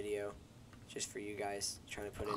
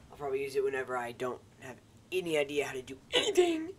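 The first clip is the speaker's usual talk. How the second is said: silly voice; 3 semitones higher, 6 dB louder than usual. Pretend. Silly self mocking voice.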